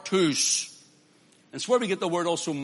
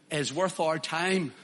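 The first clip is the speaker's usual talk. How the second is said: usual speaking voice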